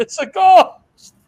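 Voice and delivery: high, loud, drawn-out cry of mock terror; hammed-up panic, stretching the words; terrified. Disciples spot a figure on the water, terrified. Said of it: "they were terrified".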